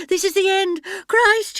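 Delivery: high, loud, desperate; panicked cry. Acted. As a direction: cry out in terror. Lost woman panics in desert.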